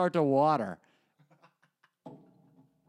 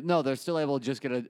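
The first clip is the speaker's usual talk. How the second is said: ordinary talk, nothing marked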